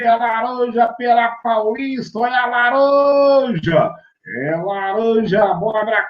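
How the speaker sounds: sing-song market seller hawking oranges; street vendor cry. Retold or imitated. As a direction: call out like a street fruit seller. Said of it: "falando assim, ó".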